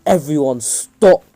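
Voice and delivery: loud, slowed command to halt. Retold or imitated. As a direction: raise your voice over the whole room. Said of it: "raises his voice like".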